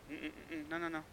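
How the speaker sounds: a quiet, hammed-up balk; mock backing out. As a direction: back away mumbling no. Believer backs out.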